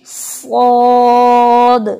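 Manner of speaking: सौद held very long and steady; heavy saud. Shown as a demonstration. Teacher models the heavy letter सौद, drawn out. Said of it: "दूसरा हर्फ है सौद".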